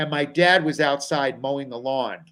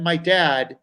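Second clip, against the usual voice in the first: ordinary talk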